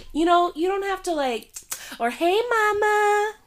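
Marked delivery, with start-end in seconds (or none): 2.1-3.3 high, slow cooing; mock coworker coo. Mimicking coworkers greeting the pregnant teacher, slow cooing. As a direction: coo it like talking to a baby.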